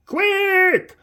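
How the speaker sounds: very high loud shout, slow after; high slow urgent shout. Acted. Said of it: "shouts the train driver".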